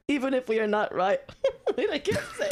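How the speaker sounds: breaking up with laughter while reading; can't get it out for laughing; real laughing fit. Real, not acted. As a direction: try to read aloud and crack up. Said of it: "I just can't stop laughing".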